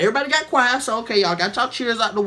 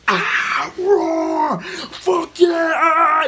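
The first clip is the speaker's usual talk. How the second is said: crowd roar; 8 semitones higher, much slower than usual. Retold or imitated. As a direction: roar it like the whole theater going wild. Crowd roaring for Stan Lee.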